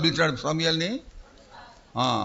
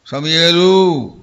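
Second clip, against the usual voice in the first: slow divine call; 8 dB louder, much slower than usual. Acted. God calls Samuel third time.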